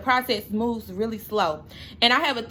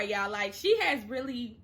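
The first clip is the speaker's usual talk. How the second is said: ordinary delivery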